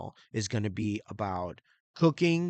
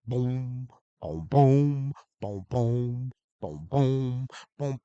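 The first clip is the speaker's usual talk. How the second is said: imitated stand-up bass; much slower than usual. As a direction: hum out booming plucked bass notes. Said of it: "a stand-up bass, like".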